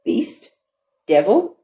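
puzzled echo, questioning each word; Beast? Devil? repeated back as wounded questions; hurt disbelief. A narrator's reading. Ox repeats the Brahmin's insults, a mild hurt surprise. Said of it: "Great Joy thought".

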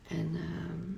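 soft words around a sip; talking into a coffee cup; while sipping a drink. Real, not acted. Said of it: "Ik ga even een slokje nemen".

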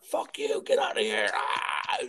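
strained, mock-crazed yell ending in a shriek; retold screaming. Man at dumpster driving coworker off, mock screaming. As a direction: imitate a man screaming him away. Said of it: "screaming at him and shit".

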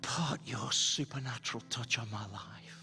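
hushed prayer. Real, not acted. Pastor asking everybody at home to pray, hushed prayer. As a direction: gently lead them into prayer, softly.

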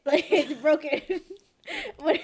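laughing as she admits it. Real, not acted. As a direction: giggle through it. Laughs retelling broken earring.

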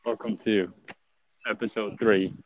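muffled behind a face mask; talking through a mask. Real, not acted. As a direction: speak through a cloth mask. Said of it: "I'm talking through a mask too".

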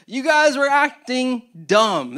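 loud, slow, scolding jab at the crowd; mock-scold them, drawing it out. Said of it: "a little bit more scolding here".

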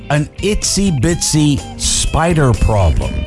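usual speaking voice

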